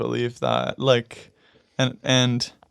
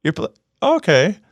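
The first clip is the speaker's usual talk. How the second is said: high, chirpy voice of a surprised restaurant worker; 7 semitones higher, 4 dB louder than usual. Retold or imitated. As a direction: mimic the staffer, pitched way up and perky. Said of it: "sometimes they're like".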